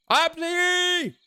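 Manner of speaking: very high-pitched little squeal; squeaky cameo cry. Pretend. Player imitating the missed Obsi cameo, squeaky. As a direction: pipe the name out in a squeaky put-on voice.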